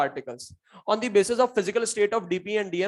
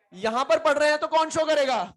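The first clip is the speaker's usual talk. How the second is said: emphatic call-out; 8 semitones higher than usual. Shown as a demonstration. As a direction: call it out to the class like a challenge. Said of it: "teacher repeats the line".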